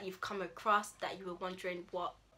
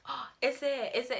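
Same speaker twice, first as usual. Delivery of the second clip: surprised; 5 semitones higher, 4 dB louder than usual. Acted. Acting out reaction to friend's news.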